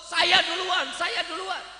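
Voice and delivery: merchants calling out over each other; eager shouting. Acted. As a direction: shout to be first in line. Merchants shouting to buy first. (teriakan rebutan para saudagar)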